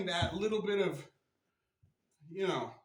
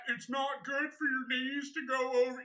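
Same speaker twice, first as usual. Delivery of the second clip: a higher, sing-song mock of the naysayers; mocking the critics; 6 semitones higher than usual. Mocking the critics who warn about knees over toes. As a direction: parrot the critics with a sneer in the voice. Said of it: "All that".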